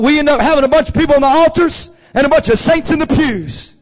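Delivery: loud, urgent exhortation; full-force preaching. Real, not acted. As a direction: drive each phrase out to the congregation. Preaches to congregation.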